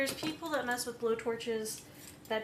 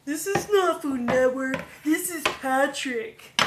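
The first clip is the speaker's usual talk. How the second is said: loud, drawn-out cartoon protest; Patrick Star; 2 semitones higher, 9 dB louder than usual. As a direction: answer a wrong number like an indignant cartoon. Acts out Patrick's phone line.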